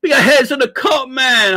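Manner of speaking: big high, loud cry of greeting; excited shout-out. Real, not acted. Streamer shouting out a viewer, high and loud. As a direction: hype the viewer up.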